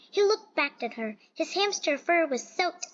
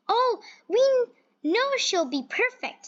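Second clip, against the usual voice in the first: admiring man; 9 semitones higher, 4 dB louder than usual. Acted. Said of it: "said a man".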